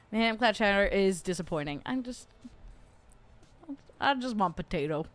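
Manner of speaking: usual speaking voice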